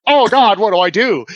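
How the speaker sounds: high, loud mock-panicked cry; retold panic. Driver with a man under his truck, mock panic. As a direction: wail helplessly, hamming up the panic.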